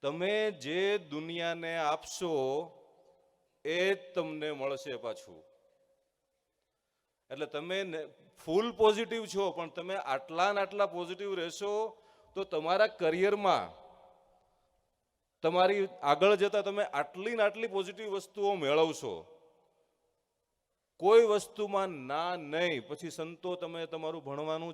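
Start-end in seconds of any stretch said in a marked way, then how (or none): 0.0-5.4 slow, weighty lesson line; slow moral. Real, not acted. Teacher spelling out the story's lesson to students, slow and weighty. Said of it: "તો એ શું હતું?". 8.5-22.9 louder, higher, rallying; rousing encouragement. Real, not acted. Speaker rallying gurukul students to stay positive, loud and rousing.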